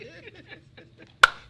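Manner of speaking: high, breathy burst of laughter; loud laugh. Real, not acted. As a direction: crack up hard. Big laugh at the joke.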